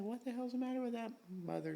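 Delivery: high, quiet, quick squeaky disbelief; a breathless high-pitched what-the-hell; incredulous mock outrage. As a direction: squeak it out like you can't believe him. Inner reaction to sponsor's outburst.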